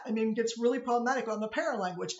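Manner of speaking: ordinary delivery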